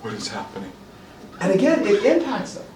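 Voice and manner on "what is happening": small, low, hushed stunned voice; shell-shocked child. Acted. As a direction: play a dazed little kid, much softer. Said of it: "little tiny shell-shocked kids".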